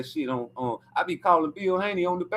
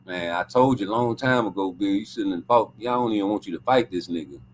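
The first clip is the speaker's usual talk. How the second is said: low, knowing I-told-you-so voice; imagined gloating; 11 semitones lower than usual. Pretend. Him gloating at Bill over the Rolly fight, low and smug. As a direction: sink the voice and gloat. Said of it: "I'm gonna be like".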